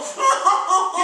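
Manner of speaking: loud high ticklish laugh; squealing laugh. Acted. Dragon laughing hard as the children tickle him. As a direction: shriek with laughter.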